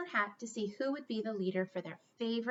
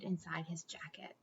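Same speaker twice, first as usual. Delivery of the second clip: hushed storytelling whisper; soft whisper for the shy rat; 5 semitones lower, 10 dB quieter than usual. Acted. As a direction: whisper it to the kids. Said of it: "whisper while telling shy part".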